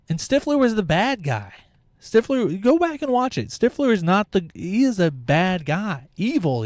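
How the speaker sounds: high, pushing the point hard; emphatic. Real, not acted. Insisting Stifler is the villain.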